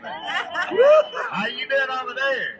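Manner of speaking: slow, high, stretched call across the water; calling from far away. Real, not acted. Calling to people on shore.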